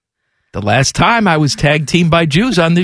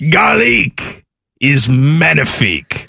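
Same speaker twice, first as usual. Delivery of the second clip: slow, put-on infomercial host; Larry King; much slower than usual. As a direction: stretch it out like a hammy celebrity pitchman. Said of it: "Larry King, he's dead".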